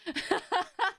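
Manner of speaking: high-pitched burst of laughter; laughing. Real, not acted. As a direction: crack up at your own joke. Cracking up at own accent.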